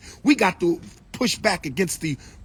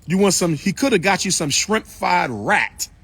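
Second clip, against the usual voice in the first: normal talking voice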